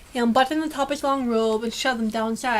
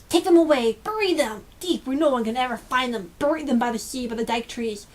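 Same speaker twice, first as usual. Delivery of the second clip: commanding; 3 dB louder than usual. Acted. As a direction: bark the orders down from the window. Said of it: "commanded Lord Drone".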